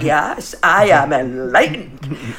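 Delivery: slow, deep self-satisfied drawl; smug. Retold or imitated. Accepting compliments, mock-smug and slow. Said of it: "You're like".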